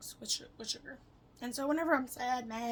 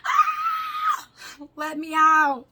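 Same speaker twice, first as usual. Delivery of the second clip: high, full-volume scream for release; acted frantic shriek, very loud; panicked scream; 6 semitones higher, 16 dB louder, much slower than usual. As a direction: scream as if trapped. Acts out being inside her head.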